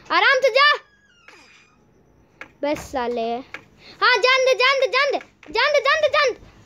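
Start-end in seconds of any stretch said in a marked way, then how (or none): 0.1-0.9 loud, high warning; exasperated yell. Real, not acted. Snaps after coaxing. 4.0-6.5 high, rhythmic egging on; excited urging. Real, not acted. Urging character onward.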